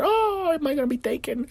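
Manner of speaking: high, mock-worried squeak; mock fretting. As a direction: fret like a nervous believer. Rapture believer fretting.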